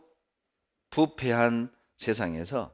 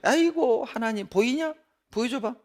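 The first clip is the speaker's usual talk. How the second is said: high, sneering mock sigh and jeer; sneering mockery; 12 semitones higher than usual. Preacher voicing a scoffer taunting Noah, high and sneering. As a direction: taunt like a scoffer laughing at believers. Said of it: "비꼬는 거예요".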